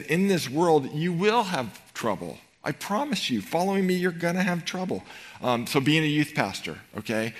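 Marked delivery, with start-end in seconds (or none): 0.0-5.0 raised, emphatic promise of trouble; forceful promise. Acted. Jesus warning followers, emphatic and raised. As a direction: press the warning hard, rising in pitch.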